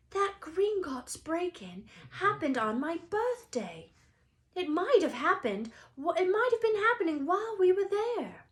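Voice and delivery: Harry builds to alarm. Acted. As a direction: realize it, excited.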